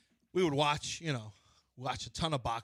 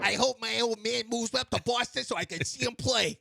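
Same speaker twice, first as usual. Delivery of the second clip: lightly imitating a thirties kid; a touch of old-time kid voice; 1930s kid voice; 8 semitones higher, 5 dB louder than usual. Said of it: "listen to some of the old phrases they use".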